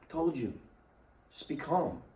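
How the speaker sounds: soft calming voice of God; reassuring God. Acted. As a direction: gently settle frightened people. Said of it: "God is saying".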